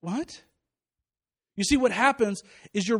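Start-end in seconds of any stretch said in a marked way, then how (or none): 0.0-0.4 small, soft, faltering question; bewildered protest. Acted. Believer questioning God's discipline, quietly bewildered. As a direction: trail off, then a quiet puzzled "what". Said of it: "And we go".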